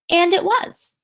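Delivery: ordinary delivery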